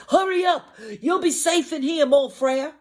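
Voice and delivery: high loud frantic call; high, loud panicked pig shout; urgent shout. Acted. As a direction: yell for him to hurry inside. Pig calling brother to safety.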